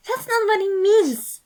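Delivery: high, loud urgent protest; exclaim. A narrator's reading. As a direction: burst out in protest. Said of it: "Patton exclaimed".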